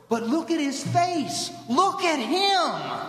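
high, drawn-out cry of wonder; amazed little guy. Acted. Little guy pointing out the changed man, high awed cry. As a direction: stretch the key words high, as if awestruck. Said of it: "some little guy goes".

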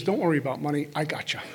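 low, soft reassurance; quiet inner voice. Acted. Godly voice easing money worries, quiet and reassuring. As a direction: reassure gently, dropping away softly. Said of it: "This is the voice that says".

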